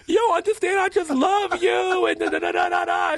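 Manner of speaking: high, loud gushing fan shout; starstruck shout. Retold or imitated. Stylist confessing love to Eve, loud high gushing. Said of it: "I was like".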